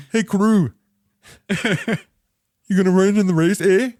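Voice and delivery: a loud, silly Canadian calling out; Canadian accent. Retold or imitated. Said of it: "that Canadian accent was".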